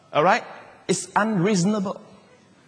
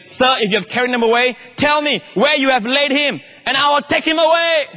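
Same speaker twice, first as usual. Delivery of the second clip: high, rising plea that builds to a near shout; Mary pleading; 9 semitones higher, 3 dB louder than usual. Acted. Preacher voicing Mary at the tomb, pleading and loud. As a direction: cry out desperate to take him back. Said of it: "She says".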